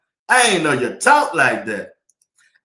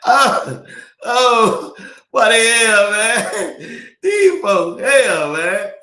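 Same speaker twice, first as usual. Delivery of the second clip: laughing disbelief, pitched way up; excited outburst; 7 semitones higher, much slower than usual. Real, not acted. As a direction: crack up and holler at the chat. Erupts at chat comment.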